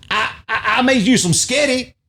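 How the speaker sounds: high stammering mock woman. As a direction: stumble over it in a high voice. Retold Cops arrest argument.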